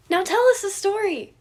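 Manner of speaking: put-on loud, high impatient boy; demanding kid. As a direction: insist like an eager child. Remus demands story.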